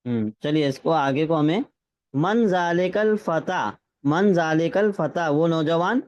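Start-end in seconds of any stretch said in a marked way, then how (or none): 2.2-5.3 slow, word by word Arabic reading; slow dictation. Shown as a demonstration. Teacher reading the Arabic example slowly to the class. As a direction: read it out slowly for students to follow. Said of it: "teacher reads example slowly".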